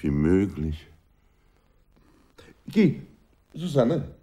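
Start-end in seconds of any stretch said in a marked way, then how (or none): 2.7-4.1 calling out. Acted. Calls to Susanne.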